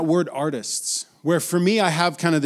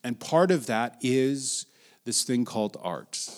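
normal talking voice

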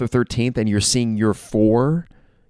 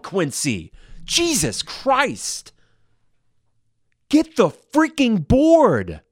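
furious, much higher yells at the screen; fired-up shouting; 12 semitones higher, slower than usual. Real, not acted. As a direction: yell at your player to grab the rebound. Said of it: "I got fired up there".

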